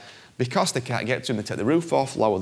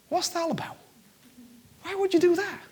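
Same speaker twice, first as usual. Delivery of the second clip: high, baffled questions; why on earth would you do that; incredulous. Real, not acted. Preacher baffled at Jesus leaving the crowd, high incredulous questions.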